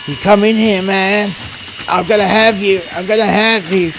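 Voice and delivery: high, loud, unhinged voice; crazed threat. Retold or imitated. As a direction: threaten them like a cornered madman. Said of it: "the guy behind the door going".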